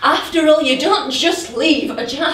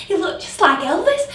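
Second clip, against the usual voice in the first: usual speaking voice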